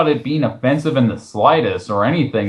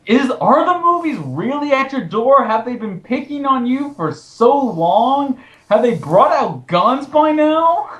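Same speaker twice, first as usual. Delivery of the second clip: much higher, mock-concerned questions; sarcastic mockery; 12 semitones higher than usual. Real, not acted. Host mocking the blogger's fear of movies, sarcastic and high. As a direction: tease him as if the movies were hunting him.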